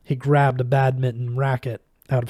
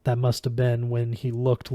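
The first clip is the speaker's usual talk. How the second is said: usual speaking voice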